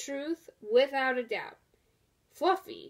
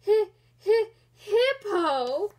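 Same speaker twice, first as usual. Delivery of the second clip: higher and louder, hiccuping laughs; laughing confession; 7 semitones higher, 6 dB louder than usual. Acted. Rhinoceros confesses its name while laughing hard. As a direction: stutter the name through laughter.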